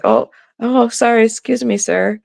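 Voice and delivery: high-pitched, courteous little apology; polite apology. Retold or imitated. Daughter to older shopper in the aisle, politely apologetic. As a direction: imitate a polite young adult, voice lifted. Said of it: "not used to people being polite".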